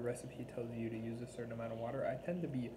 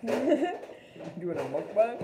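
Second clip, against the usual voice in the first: laughing; 10 semitones higher, 8 dB louder, much slower than usual. Real, not acted. Partner laughs at her eating.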